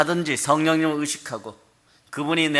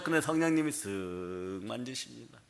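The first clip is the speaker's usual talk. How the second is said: gentle hush; 4 semitones lower, 11 dB quieter than usual. Acted. Spirit gently touching the believer.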